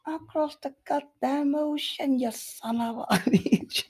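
very high, slow squeal in a mock accent; hammed-up falsetto outrage; aggressive high-pitched accent. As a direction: screech the insult in a put-on accent, breaking into laughter. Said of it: "the higher pitched the voice gets".

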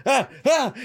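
high, loud startled yelp of fright. Retold or imitated. Mom retelling how she yelped when her son startled her. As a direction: jump and yelp, caught off guard. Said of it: "freaked out".

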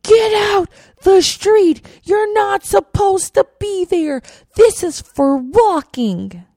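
put-on high, loud, slow barking yell of an old man; retold angry yell. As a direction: yell it at her like a cranky stranger. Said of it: "He yells at me".

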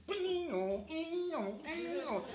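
high siren wail voice; siren imitation. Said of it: "and he goes".

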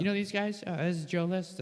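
high-pitched impression making introductions; Bobby Kelly. Comic retelling another comedian introducing him at the table, high-pitched impression. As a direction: introduce people in a high, put-on voice. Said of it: "it's like this high-pitched".

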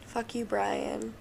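ordinary talk, nothing marked

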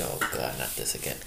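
low, soft, fed-up mutter; weary groan. Retold or imitated. Hearing Larry's marriage woes again, a soft fed-up groan. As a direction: sigh it out like you've heard it a hundred times. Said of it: "And you're like".

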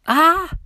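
put-on high, loud girl's scream. A narrator's reading. Millie jumping off the roof, high scream. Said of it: "Millie screamed".

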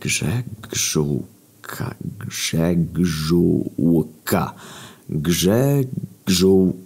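very slow whisper, syllable by syllable; whispered syllabizing. Acted. Grzegżu counting syllables of his surname, slow whisper. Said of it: "sylabizuje szeptem swoje nazwisko".

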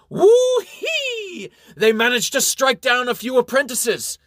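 very high, loud whoop into an elated shout; triumphant whoop. A narrator's reading. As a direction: whoop and holler the good news. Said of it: "whoops in triumph".

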